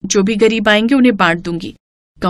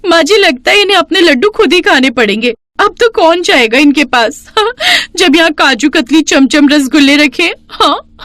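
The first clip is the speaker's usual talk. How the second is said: put-on gloating young bride; 8 semitones higher than usual. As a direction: crow over the rival. Payal gloats.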